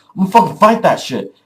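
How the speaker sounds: fast, loud, high burst; acted-out gung-ho bravado; cocky battle cry. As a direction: rush it out, eager to brawl. Acts out fighting instinct.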